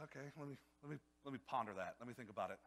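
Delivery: low, soft musing; pondering. Acted. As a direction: act out thinking it over, almost to oneself. Man in parable mulls God's offer.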